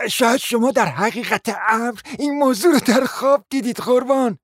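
lightly voiced, condescending; mild mock sympathy; a hint of a smug guest. Guest suggesting the hostess only dreamt it.

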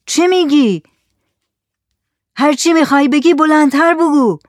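high, loud demand to speak up; shouting. Acted. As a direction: shout as if across a distance. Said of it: "زکریا داد زد". (فریاد بلند و پرسشگر)

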